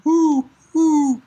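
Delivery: high, drawn-out owl hoots; owl hooting. Acted. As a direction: hoot like a perched owl. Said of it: "the owl's just sitting there going".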